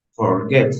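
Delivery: "Forget" with the weight on GET; stress on last syllable. Shown as a demonstration. Teacher giving an example of final stress, "Forget" stressed on GET. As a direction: push the second syllable of "Forget". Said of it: "en la última".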